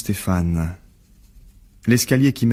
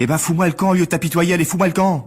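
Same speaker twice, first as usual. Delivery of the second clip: angry shouting; 10 semitones higher, faster than usual. Acted. Furious dismissal of pitying visitor.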